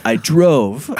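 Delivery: mock Russian confession; comic Russian convict owning up; Russian accent. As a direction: play along with a hammed-up Russian accent. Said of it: "I don't know why he's Russian".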